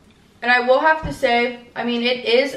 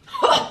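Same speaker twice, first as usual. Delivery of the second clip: mock high laugh. Fake energy laugh.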